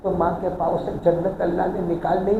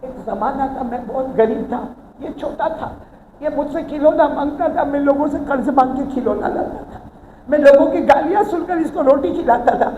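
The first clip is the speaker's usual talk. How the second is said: put-on higher, louder, rising lament of an old father; old father's lament; 8 semitones higher, 6 dB louder than usual. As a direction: plead as a hurt old father whose son ignores him. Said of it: "और फिर वो बूढ़ा बाप कहने लगा".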